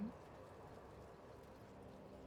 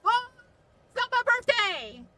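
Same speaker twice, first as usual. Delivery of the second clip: loud, high excited cry; excited outburst; 7 semitones higher, 7 dB louder than usual. Real, not acted. Gamer yelling at a teammate, excited. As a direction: burst out in mock outrage. Excited greeting and protest in game.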